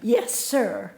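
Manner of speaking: a grandmother on her knee with a put-on Southern accent; old Southern accent. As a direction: tell the grandchild the tale in a Southern lilt. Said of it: "I don't know why I have that accent".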